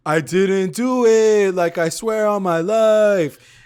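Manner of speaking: high, loud, drawn-out protest; mock pleading. Ex swearing innocence on the boat, mock pleading. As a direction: slowly wail an exaggerated denial. Imitating ex's denial to her.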